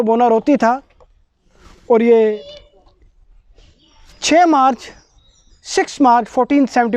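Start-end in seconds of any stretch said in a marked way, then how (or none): none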